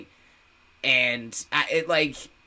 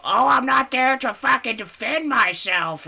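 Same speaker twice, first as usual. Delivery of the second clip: high, loud put-on complaining voice; mock her throwing a fit; hammed-up indignant whine, pitched way up; Michaela; 12 semitones higher, 6 dB louder than usual. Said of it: "that's my Michaela impression".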